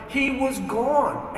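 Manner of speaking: slow, drawn-out he was gone; slow, stunned. Retold or imitated. After searching the empty street, a slow, stunned repeat. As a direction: let he was gone hang, slow and disbelieving.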